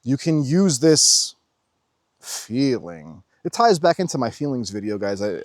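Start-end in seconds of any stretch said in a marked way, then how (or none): none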